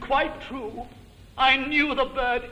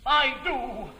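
normal talking voice

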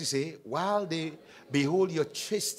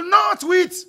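high, very loud mimicked prohibition; exaggerated shouted rule; mock insistent shout; 11 semitones higher, 11 dB louder than usual. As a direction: shout it high, as others lay down the law. Mimics what they will tell you.

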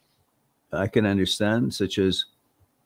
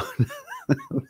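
high laugh; 5 dB quieter than usual. Real, not acted. Laughs after accent bit.

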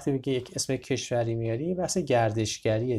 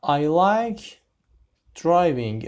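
announced sentence; 4 semitones higher, 6 dB louder, much slower than usual. Shown as a demonstration. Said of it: "teacher announces new sentence".

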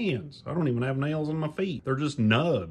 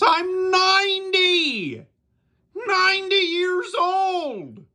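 much higher, slow, loud bellow; proud shout; 5 dB louder, slower than usual. Acted. Ninety-year-old puppet shouting his age at Damien. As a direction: trumpet your age to the room.

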